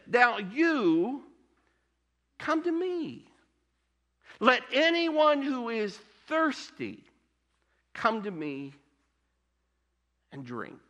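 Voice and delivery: high, slow calling invitation fading to a hush; Jesus's invitation. Retold or imitated. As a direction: call them in, then soften on the last words. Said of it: "Jesus says".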